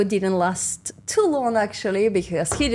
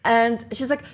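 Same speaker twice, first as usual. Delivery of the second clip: ordinary talk, nothing marked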